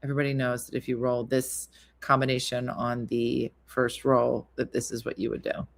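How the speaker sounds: low, lecturing rules voice; lecturing know-it-all. Retold or imitated. Missy lecturing Dina on the opening move, condescending. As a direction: talk down like rules are obvious. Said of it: "where she's like".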